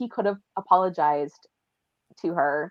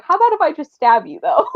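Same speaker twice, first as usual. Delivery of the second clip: casual threat through laughter, louder and higher; laughing mock villain; 8 semitones higher, 8 dB louder than usual. Friend voicing Dorian to Basil, laughing. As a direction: offer the stab like a snack. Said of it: "Dorian's like".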